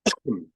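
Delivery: sick throat clear; clearing a sore throat. Real, not acted. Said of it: "I'm really struggling to talk here".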